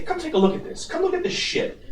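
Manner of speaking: mock-outraged "come see this"; exasperated retelling. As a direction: retell it as an incredulous, high-voiced summons. Retelling a call to coworker.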